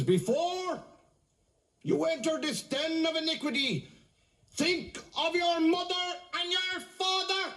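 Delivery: very high-pitched scolding nun; drawn-out, high moralising warning; preaching nun. Acted. A nun warning a man outside a bar, high-pitched preaching.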